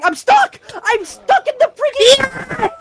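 panicking gamer. Real, not acted. Gamer stuck drowning underwater.

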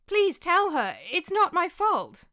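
high, loud desperate pleading; terrified begging plea. A narrator's reading. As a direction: plead with his master to save him. Slave begging master.

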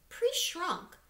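a touch of reading the label. A narrator's reading.

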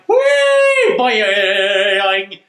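high gleeful squeal, then a bouncy boing; excited squeal. Retold or imitated. Kimmy ogling a hot dress, gleeful squeal. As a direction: squeal with childish delight. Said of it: "and Kimmy's like".

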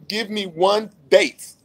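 ordinary talk

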